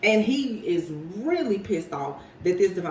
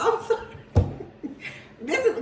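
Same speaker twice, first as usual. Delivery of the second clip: laughing apology; 8 semitones higher than usual. Real, not acted. Laughing at her own reading.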